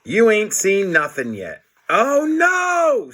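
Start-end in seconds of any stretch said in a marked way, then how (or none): 1.9-3.1 high panicked scream. A narrator's reading. Harold sees Mister Krupp turning, high panicked scream. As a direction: shriek it in alarm. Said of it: "screamed Harold".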